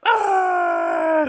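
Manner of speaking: long, very high held scream; imitated famous yell. Imitating the show's famous quote, a high comic scream. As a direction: shriek one sustained comic note. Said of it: "he, he did that".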